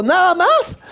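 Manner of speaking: high, rising disbelief; surprised question. Retold or imitated. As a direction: ask it high as if you can't believe it. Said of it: "Ella me dijo".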